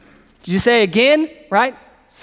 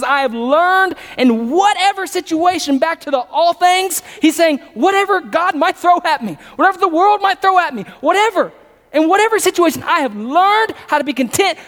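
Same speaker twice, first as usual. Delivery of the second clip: preaching at full force; 7 semitones higher than usual. Acted. Preacher pressing the verse on his congregation at full force. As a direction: drive the verse home to the room.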